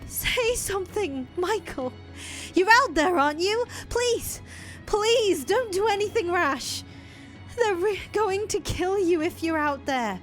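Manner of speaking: fearful pleading through a door; frightened pleading. Acted. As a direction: plead with him not to go. Giselle begs through the door.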